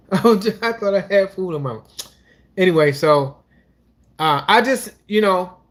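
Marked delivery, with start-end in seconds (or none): 0.1-2.1 laughing at yourself, higher. Real, not acted. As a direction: laugh through the words.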